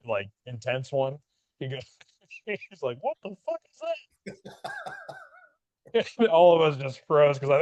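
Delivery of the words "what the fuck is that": high squeaky mock instructor voice; imitating the drill instructor. Trainer imitating a shocked drill instructor, high mock voice. TI reacts to piss puddle.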